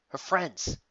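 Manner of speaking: panicked. A narrator's reading. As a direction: fire off the questions in growing worry. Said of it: "She only grew more panic".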